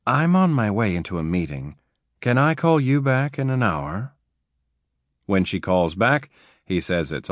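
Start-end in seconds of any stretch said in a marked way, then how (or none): 0.1-4.1 seductive. A narrator's reading. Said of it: "In a seductive voice".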